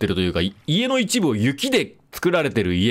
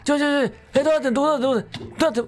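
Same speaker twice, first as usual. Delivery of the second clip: confused panic; 12 semitones higher, much faster than usual. Real, not acted. Trapped, confused in game.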